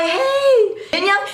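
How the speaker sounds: high, drawn-out mock objection; indignant protest. [name] objecting to scribbles on his face, high indignant protest.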